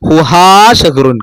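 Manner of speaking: ഹുഹാ with the last ആ held long, slowly; long final a. Shown as a demonstration. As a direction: stretch ഹുഹാ before ഷഹ്റുൻ. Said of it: "അപ്പൊ അലിഫ് നീട്ടുന്നുണ്ട്".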